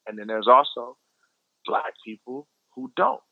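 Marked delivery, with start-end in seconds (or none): none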